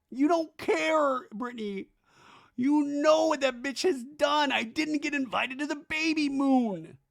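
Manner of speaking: high, slow, hammed-up whining; mock meltdown. Drunk Zach accusing Brittany, high mock wail. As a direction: wail the grievance in a put-on high voice.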